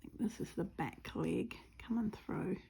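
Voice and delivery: whispered to herself while stitching; soft whisper, concentrating. Real, not acted. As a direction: murmur it under your breath.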